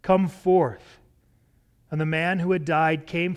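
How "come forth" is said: a loud ringing cry of "come forth"; loud call. A narrator's reading. Jesus calling Lazarus from the tomb, loud cry. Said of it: "he cried out with a loud voice".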